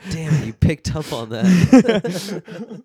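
loud, high, slow through laughter; real laughter stretching the words; laughing. Real, not acted. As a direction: crack up while saying it. Laughing reaction to being mimicked.

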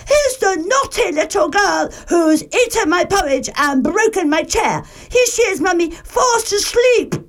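put-on little bear shows his mummy the girl, high and crying; Baby Bear crying out. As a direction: shriek it to Mummy. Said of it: "and cried".

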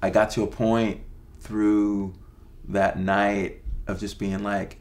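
ordinary delivery

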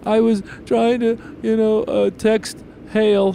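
put-on high, slow little-boy voice; little kid voice. Dad voices his four-year-old son.

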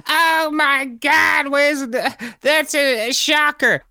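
hammed-up scandalised passenger; over-dramatic American. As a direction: stretch out the horror for laughs. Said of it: "an over-dramatized, screamy kinda reaction".

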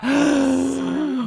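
high, imitated gasp of shock; mock horrified intake of breath. Group's reaction to misdirected message.

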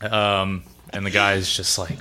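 ordinary talk

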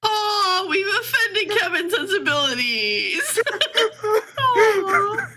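laughing mockery. Mocking Kevin's offended sensibilities, laughing. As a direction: laugh while pretending to pity him.

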